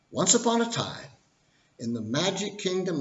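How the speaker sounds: high, lilting once-upon-a-time voice; storybook opening. Shown as a demonstration. Opening the financial crash fairy tale, storybook narration. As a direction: launch the tale like a bedtime storyteller. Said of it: "So here we go".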